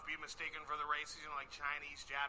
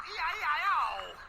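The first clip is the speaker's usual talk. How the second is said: louder, higher, slow sung scream; mock scream; 10 semitones higher, 7 dB louder than usual. Comic imitating the bitten man screaming, mock sung. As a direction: yelp it out as a song. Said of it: "The man screamed out".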